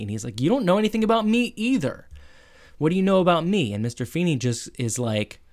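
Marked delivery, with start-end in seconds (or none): 0.4-3.7 higher, quick retold comeback; indignant retort. Sean retorting to his principal, indignant. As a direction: fire it back, mock-indignant.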